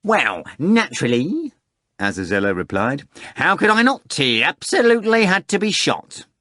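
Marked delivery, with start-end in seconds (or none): 0.0-1.5 raised, matter-of-fact henchman; a hint of a blunt henchman; Azazello's voice. 3.4-6.3 raised, matter-of-fact henchman; a hint of a blunt henchman; Azazello's voice.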